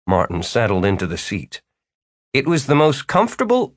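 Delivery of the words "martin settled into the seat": ordinary delivery, nothing marked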